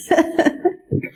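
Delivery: laugh. Real, not acted. Laughing at own joke.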